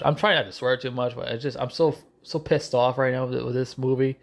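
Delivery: usual speaking voice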